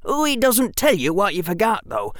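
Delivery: put-on high, quick boyish character voice; high-pitched chattering boy; eager schoolboy. Same boy explains.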